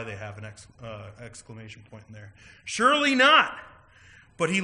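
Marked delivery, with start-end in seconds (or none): 2.6-3.6 loud, high, indignant cry of protest; hostile outburst. Retold or imitated. Preacher acting the Pharisees' outrage at Jesus, loud and hostile. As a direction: hurl it back at him, outraged. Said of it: "we know that they are being hostile about this".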